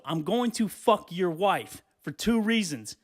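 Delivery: ordinary talk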